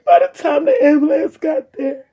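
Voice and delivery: real tears, pushing words through them; crying. Real, not acted. Recalling her brother's death, speaking through tears. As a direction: voice breaking high as she recounts it.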